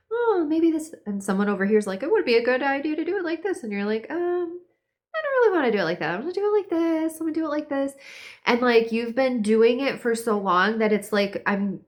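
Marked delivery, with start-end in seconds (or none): 0.1-0.9 higher-pitched mock brainstorm; imitated tentative suggestion, high; musing aloud. Voicing early indecision. 2.0-3.6 quick, high-pitched imitation of a meddler; mock helpful suggestion; unsolicited adviser. Voicing an unwanted adviser. 4.1-7.9 high-pitched, hesitant imitated reply; mock polite reluctance; reluctant refusal. Voicing the listener's reluctant reply.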